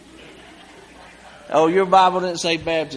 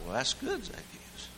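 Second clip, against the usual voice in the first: a quiet approving murmur; Jesus softly approving Zacchaeus; gentle Jesus; 15 dB quieter than usual. Acted. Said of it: "Jesus like".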